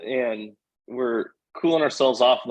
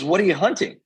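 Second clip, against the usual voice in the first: curious hiker; 7 semitones higher, faster than usual. Retold or imitated. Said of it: "She goes".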